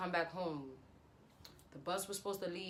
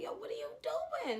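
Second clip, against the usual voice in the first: very high falsetto bewilderment; squeaky disbelief. Retold or imitated. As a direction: squeak out a baffled question. Retelling her baffled reaction.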